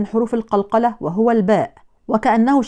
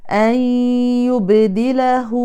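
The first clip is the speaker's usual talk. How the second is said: slow, drawn-out chanted recitation; Quran recitation; 2 semitones higher, much slower than usual. Acted. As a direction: recite the verse in measured tarteel. Recites the verse.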